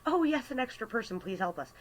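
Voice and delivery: high, quick burst easing down; mock-relieved cry for help; eager plea. Short-staffed store greeting an extra person, eager.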